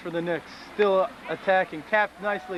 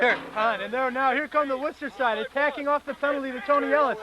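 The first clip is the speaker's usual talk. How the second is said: lively sports commentator voice; play-by-play commentary; 4 semitones higher than usual. Pretend. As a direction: call the match like live TV. Mock play-by-play.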